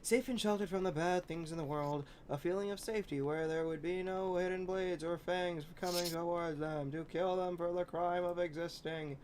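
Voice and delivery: ordinary delivery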